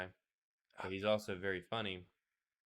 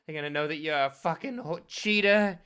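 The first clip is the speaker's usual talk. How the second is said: high, loud, shrill screaming accusation; shrill sobbing outrage; 10 semitones higher, 11 dB louder than usual. Pretend. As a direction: scream it like a betrayed girlfriend. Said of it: "You play the battered girlfriend".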